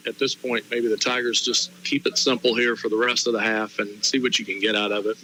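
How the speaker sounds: ordinary talk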